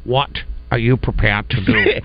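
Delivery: slow, low movie-star impression; Sean Connery. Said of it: "Sean Connery".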